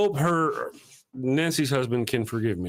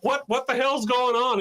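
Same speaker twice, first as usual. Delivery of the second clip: confused husband; 9 semitones higher than usual. Retold or imitated. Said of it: "he's like".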